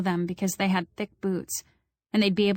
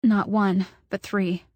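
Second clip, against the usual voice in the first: usual speaking voice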